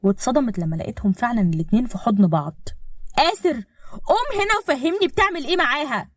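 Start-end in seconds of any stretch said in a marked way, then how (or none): 3.2-6.1 furious. A narrator's reading. Fiancée bursting in on the couple, furious. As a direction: confront him, voice pitched right up. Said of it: "أسيف اتجننت واتعصبت من كلامها".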